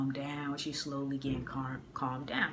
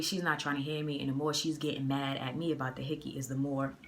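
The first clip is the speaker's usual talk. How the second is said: usual speaking voice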